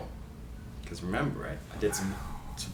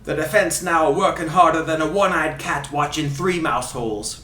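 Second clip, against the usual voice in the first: put-on Ray Hudson Scottish accent. Host reading a free kick quote, in fake Scottish accent. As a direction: fake Scottish commentator, theatrical. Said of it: "doing his accent".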